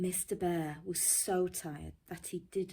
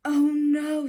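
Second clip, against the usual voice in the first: loud, high groan of frustration; exasperated Mister Bear; 9 semitones higher, 14 dB louder than usual. Acted. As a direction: cry it out like a sleepless bear at the end of his rope. Mister Bear fed up, can't sleep.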